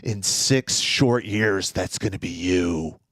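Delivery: put-on very slow, deliberate threat to the kid; warning the bully's son over his knocked-out father; menacing warning. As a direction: let every word land like a promise. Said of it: "And I go".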